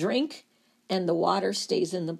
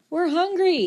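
high, loud hungry cry; yelling hungry cells; 9 semitones higher, 6 dB louder than usual. Acted. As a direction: yell it like starving cells. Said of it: "they keep yelling".

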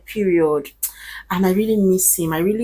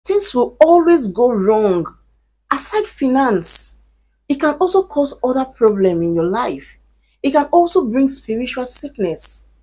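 louder and higher, climbing to a shout at the end; rising loud warning; 6 semitones higher, 6 dB louder than usual. Real, not acted. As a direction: preach the warning, building to a cry.

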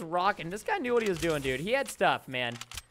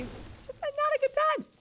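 high, thin little voice; mock spooked; 14 semitones higher than usual. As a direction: squeak it out, much higher than usual. Eerie horror-movie feeling in game.

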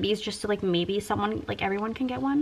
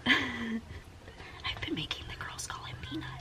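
giggly whisper; 11 dB quieter, slower than usual. Real, not acted. Giggling aside about husband.

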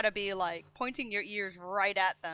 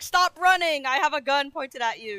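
loud, raised-pitch command to a fleeing person; acted yelled threat; 6 semitones higher, 10 dB louder than usual. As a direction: yell it as a pretend warning. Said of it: "You wanna yell".

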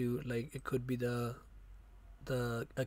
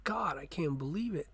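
imagined delight; 5 semitones higher than usual. Retold or imitated. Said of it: "I'm like".

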